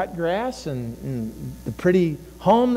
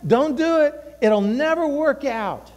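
higher, warning don't do it; nagging inner voice; 8 semitones higher than usual. Retold or imitated. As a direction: nag yourself like a doubting voice. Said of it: "this voice inside of you here that says".

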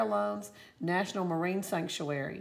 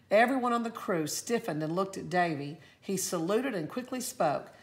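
ordinary delivery

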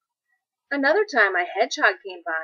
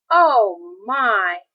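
loud, startled gasp of delight; exclaiming; 9 dB louder than usual. A narrator's reading. Hedgehog spotting the small tree, loud exclamation. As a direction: burst out in surprise. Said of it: "she exclaimed".

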